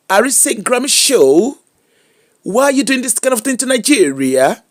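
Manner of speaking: mock American phone accent. Vlogger swearing to speak only phone from today, mock American accent. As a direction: roll it out like a fake Yankee. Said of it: "na phone na I go dey speak".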